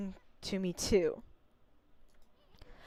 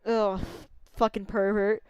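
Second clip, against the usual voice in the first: normal talking voice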